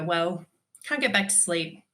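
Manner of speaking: usual speaking voice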